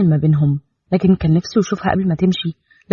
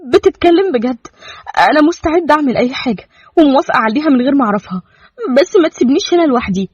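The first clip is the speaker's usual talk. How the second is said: high-pitched, eager and hopeful; happy eagerness; 6 semitones higher than usual. A narrator's reading. Young woman offered a way out to Cairo, happily eager. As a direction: plead happily in a much higher voice. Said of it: "فابتسمت بسعادة وقالت بلهفة".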